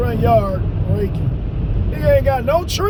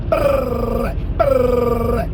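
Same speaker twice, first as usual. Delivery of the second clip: whooping noise. Retold or imitated. Imitating the whooping.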